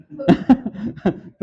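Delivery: laughing at his own joke; real laughter; hearty laugh. Real, not acted.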